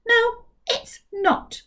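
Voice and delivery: slow, high, dignified reply; with great dignity. Acted. Captain K answers the mouth with dignity. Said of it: "said Captain K with great dignity".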